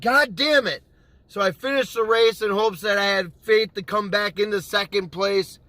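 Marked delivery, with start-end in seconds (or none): 0.0-0.8 retold pissed-off curse. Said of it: "I'm pissed. So I said".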